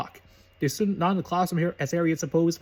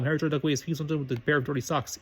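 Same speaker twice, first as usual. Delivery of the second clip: ordinary delivery, nothing marked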